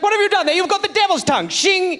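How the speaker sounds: high, alarmed mock British accent; shocked British gentleman. Colonist shocked at his friend's new accent, high British voice. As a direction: recoil in a high English voice. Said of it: "He's like".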